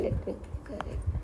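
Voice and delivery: very soft, slow murmur; barely voiced aside; muttered to self. Real, not acted. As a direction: mumble it under your breath while writing. Talking to self while correcting notes.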